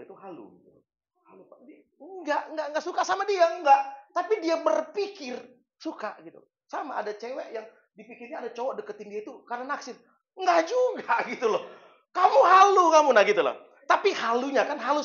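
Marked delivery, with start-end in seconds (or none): 1.7-11.6 loud, high, driving sermon delivery; fiery pulpit preaching; full-force preaching. Acted. 12.2-13.6 much louder and higher mock scolding; a friend shouts at a deluded friend.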